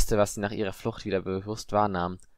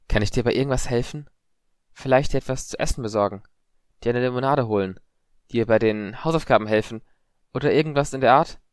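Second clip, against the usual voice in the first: slightly raised, lilting questions; earnest offer; 4 semitones higher, 5 dB louder than usual. A narrator's reading. Harry repeating his offer to Padma, earnest. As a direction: list the offers patiently.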